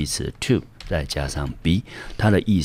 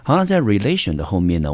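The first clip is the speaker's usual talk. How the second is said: ordinary talk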